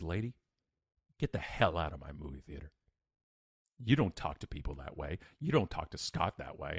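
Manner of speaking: low, measured telling-off; acted stern manager, low and controlled; stern confrontation. Manager confronts rude customer in retold scene.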